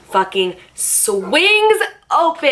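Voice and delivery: drawn out slow, high and tense; dramatic reveal. A narrator's reading. Storyteller reaching the scariest moment, slow high dramatic build. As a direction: stretch it out for suspense.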